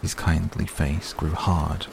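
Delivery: ordinary delivery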